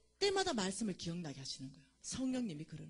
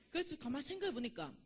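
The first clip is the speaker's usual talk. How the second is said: ordinary talk, nothing marked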